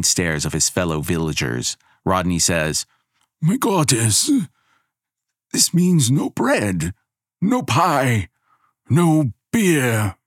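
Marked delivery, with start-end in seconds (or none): 3.4-10.2 put-on higher, slow pleading old villager; Rodney's voice. A narrator's reading. Old villager pleads with goddess, higher and dismayed. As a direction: beg the goddess for bread and beer.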